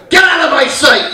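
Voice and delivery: high, loud mock-angry shout; angry dad. Dad banishes son, angry shout. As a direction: order the kid away. Acted dad ordering kid away.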